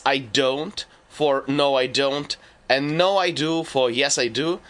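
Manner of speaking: ordinary delivery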